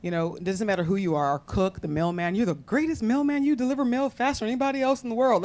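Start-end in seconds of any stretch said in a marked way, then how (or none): 2.3-5.4 high-pitched animated imitation of a flatterer; gushing retold compliment; extravagant praise. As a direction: hype them up like a champion. Father's extravagant praise, acted.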